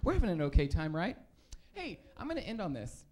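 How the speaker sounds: ordinary talk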